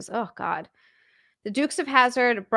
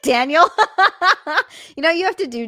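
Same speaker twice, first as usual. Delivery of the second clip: higher, louder, laughing through the words; real laughter; 8 semitones higher, 6 dB louder, faster than usual. Real, not acted. Streamer answering Daniel's chat message, laughing. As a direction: crack up while starting to give advice.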